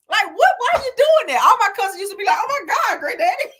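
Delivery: high, loud alarmed cry; alarmed shout. Retold or imitated. Cousins in granddad's speeding car, high loud alarmed shout. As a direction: yell out in a panic at the wild driving.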